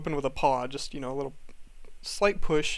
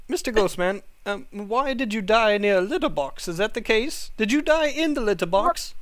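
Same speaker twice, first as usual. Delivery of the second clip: lightly voiced cat; 5 semitones higher, 4 dB louder than usual. Cat questions ghost in character.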